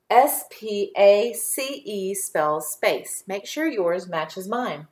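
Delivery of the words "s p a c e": slow letter-by-letter spelling; name each letter separately; measured classroom spelling. Shown as a demonstration. Spelling the word aloud.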